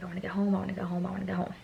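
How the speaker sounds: soft, half-whispered anxious chant; muttered plea. Retold or imitated. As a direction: repeat it under your breath like a wish. Retold inner pleading to herself.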